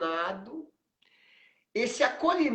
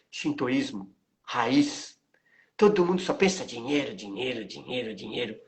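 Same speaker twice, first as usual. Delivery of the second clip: put-on weary old master; 6 semitones lower than usual. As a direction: lower and slow, a tired complaint. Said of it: "bateu na mesa assim e falou assim". (mestre cansado, voz grave e lenta)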